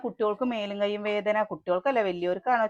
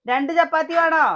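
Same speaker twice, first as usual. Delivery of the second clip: calling out; 8 semitones higher, 7 dB louder than usual. Real, not acted. Asking child across room.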